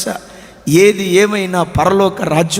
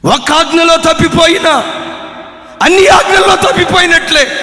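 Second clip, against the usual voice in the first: louder, ringing verse with stress on ఆజ్ఞలో; forceful repeat; 7 semitones higher, 5 dB louder than usual. Acted. Preacher repeating the law loudly to the congregation. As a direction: hammer the verse home the second time.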